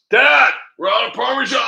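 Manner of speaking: high, loud shout across the house; yelling to dad. Retold or imitated. Calling out to dad.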